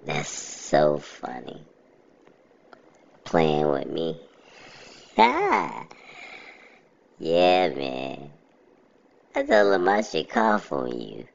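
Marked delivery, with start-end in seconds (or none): 5.2-5.8 short high-pitched laugh. Real, not acted. Laughing at own story.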